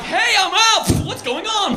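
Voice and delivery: high, slow mock call. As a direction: drag it out, confused and loud. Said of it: "I'm like".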